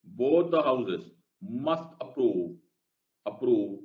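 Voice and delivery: ordinary talk, nothing marked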